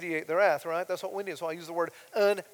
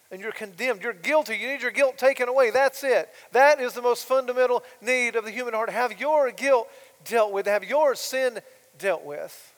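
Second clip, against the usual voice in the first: louder and higher, pressing each line; impassioned preaching; 4 semitones higher, 10 dB louder than usual. Acted. Preacher pressing listeners about their guilt, impassioned and loud. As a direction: drive it home from the pulpit.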